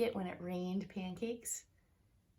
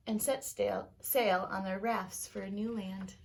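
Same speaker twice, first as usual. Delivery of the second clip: lively read-aloud storytelling voice; story narration; 4 semitones higher, 3 dB louder than usual. A narrator's reading. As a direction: tell it like a bedtime story to kids. Teacher reads picture book aloud.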